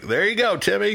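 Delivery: put-on high chirpy old-timey voice; wholesome 1950s kid. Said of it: "And like".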